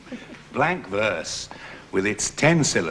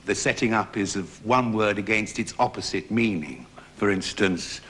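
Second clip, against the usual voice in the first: normal talking voice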